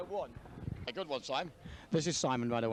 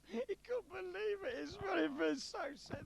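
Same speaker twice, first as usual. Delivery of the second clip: mock crying; 8 semitones higher, 3 dB quieter than usual. Joke teller pities the tiny mouse, mock crying. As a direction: put on a pitiful little sob. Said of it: "It's crying through the jungle".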